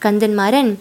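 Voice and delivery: plain reading voice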